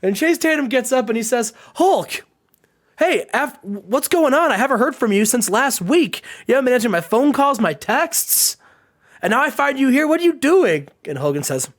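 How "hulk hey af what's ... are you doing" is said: higher-pitched, insistent acting; agitated questioning. Chase finding his silent partner working here, agitated. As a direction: demand answers from a missing friend.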